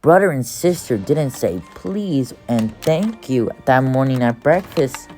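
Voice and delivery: ordinary delivery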